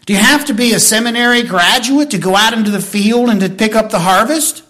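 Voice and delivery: normal talking voice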